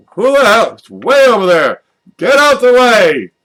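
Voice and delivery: loud high mock drunken shout; drunk yelling. Acting a drunk player at frisbee golf, loud high shout. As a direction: holler across the course like a tipsy golfer.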